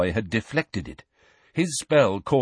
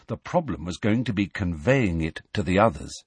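ordinary delivery

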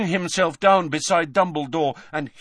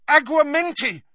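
high, urgent spell cry; Harry shouting; 13 semitones higher, 5 dB louder than usual. A narrator's reading. Harry jabbing the goblet with his wand, shouting. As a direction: shout the incantation. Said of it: "he shouted".

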